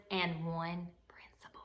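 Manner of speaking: whispered punchline. A narrator's reading. Narrator whispering the funny last item to children. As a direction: drop to a secret whisper. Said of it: "narrator whispers punchline".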